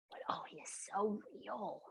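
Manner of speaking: breathy hushed awe; reverent whisper. Real, not acted. Telling the host how real God is, hushed whisper. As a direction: whisper it like a sacred secret.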